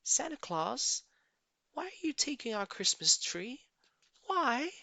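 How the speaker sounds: put-on high, small child's voice; little girl. A narrator's reading. Little Cindy Lou Who asking Santa about the tree in a high small voice. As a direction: ask it like a wondering toddler. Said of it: "She stared at the Grinch and said".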